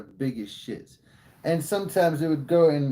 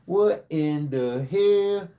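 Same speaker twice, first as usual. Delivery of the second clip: slow, louder what in the hell; stretched outrage; 5 semitones higher, 4 dB louder, much slower than usual. Retold or imitated. Yelling at the Mexican, a slow drawn-out what in the hell. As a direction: stretch out each word in mock anger.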